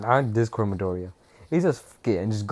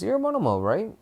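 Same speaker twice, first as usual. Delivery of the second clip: high, sing-song mock-sweet question; menacing Deku; 11 semitones higher than usual. Deku confronting Monoma, high sing-song fake sweetness. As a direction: ask it sweetly before the beating. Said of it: "and he says".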